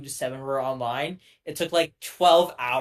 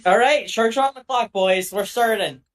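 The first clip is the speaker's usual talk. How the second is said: excited; 6 semitones higher, 9 dB louder than usual. Real, not acted. Draft pick on the clock.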